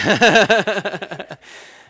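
a loud high burst of real laughter. Real, not acted. Preacher laughing at his own smacking joke, loud high laugh.

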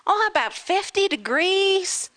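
high, slow American insistence; American lady. Retold or imitated. Surgeon's wife insists the girls wear skirts, high American voice. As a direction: insist sweetly but firmly. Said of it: "We were like".

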